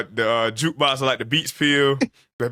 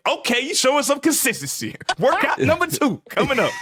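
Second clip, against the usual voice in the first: smug teammate; 11 semitones higher than usual. Retold or imitated. Imitating teammate's smug greeting.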